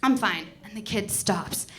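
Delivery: normal talking voice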